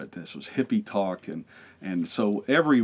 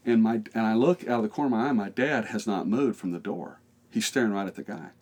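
ordinary talk

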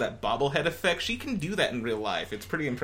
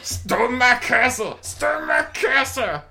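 put-on high, slow old-timer shout; Princess Bride old couple; 9 semitones higher, 5 dB louder, much slower than usual. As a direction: bellow it like a batty old man. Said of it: "the old couple in, uh, Princess Bride".

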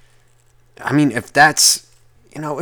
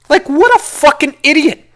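scornful; 13 semitones higher than usual. Real, not acted. Mocking scorn.

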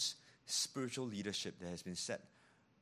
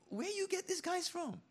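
much higher, put-on voice; a high incredulous aside; mock disbelief. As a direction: squeak out the disbelief.

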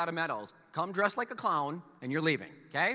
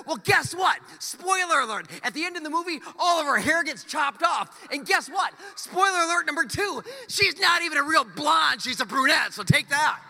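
gleeful spoiler shout; 8 semitones higher, 7 dB louder than usual. Acted. Pastor ranting spoilers at the church, loud and gleeful. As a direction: announce the spoiler with mock triumph.

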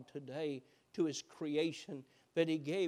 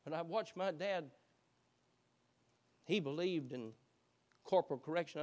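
normal talking voice

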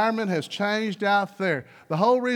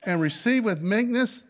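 louder, measured Bible reading; scripture reading; 3 semitones higher, 4 dB louder than usual. Acted. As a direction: read the verse out with weight. Reads scripture.

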